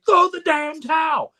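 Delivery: very high, slow, shouted coach line; intense Duke shout. Retold or imitated. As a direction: yell it straight at your fighter. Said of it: "he's got this intense, straight look".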